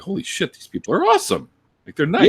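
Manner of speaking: high-pitched retold astonishment; amazed realization. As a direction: mimic newcomers discovering it, voice leaping up. Retold amazement of new atheists.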